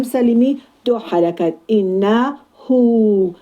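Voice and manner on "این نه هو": slow recitation of این نه هو with the final هو held long; two-count elongation. Shown as a demonstration. (کشیدن هو به اندازهٔ دو حرکت)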